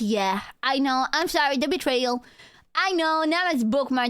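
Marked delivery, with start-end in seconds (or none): none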